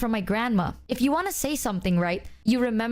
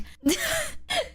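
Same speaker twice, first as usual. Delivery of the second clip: high laugh. Real, not acted. Laughs at own remark.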